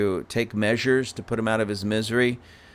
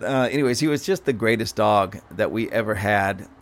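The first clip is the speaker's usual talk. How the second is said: ordinary talk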